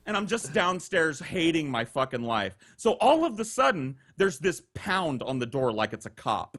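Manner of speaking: ordinary delivery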